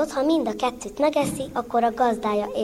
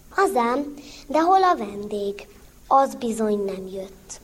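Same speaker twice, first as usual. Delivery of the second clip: usual speaking voice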